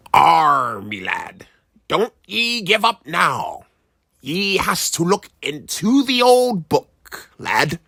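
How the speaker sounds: put-on pirate brogue coaxing the boy; an old pirate nudging him on. A narrator's reading. Pirate ancestor telling the boy to read the book, pirate voice.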